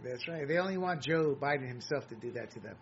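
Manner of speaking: normal talking voice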